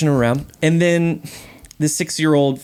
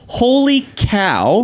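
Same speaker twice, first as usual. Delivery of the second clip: "Holy cow" slow and higher; drawn-out demonstration; 5 semitones higher, much slower than usual. Shown as a demonstration. Dad repeating what he said for the co-hosts, "Holy cow" slow and clear. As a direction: stretch out both words of "Holy cow". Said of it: "I don't know if you guys caught it".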